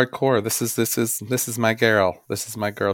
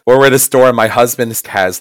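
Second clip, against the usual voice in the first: ordinary delivery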